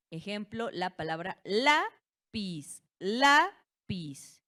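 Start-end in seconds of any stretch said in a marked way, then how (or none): 1.4-2.8 slow teacher's demo of "lápiz", heavy weight on LÁ; stress on first syllable. Shown as a demonstration. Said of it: "Recae última en la penúltima". 3.0-4.4 "Lápiz" with LÁ high and much louder, piz falling away; exaggerated LÁ-piz to show the stressed syllable; stress on first syllable. Shown as a demonstration. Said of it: "Recae última en la penúltima".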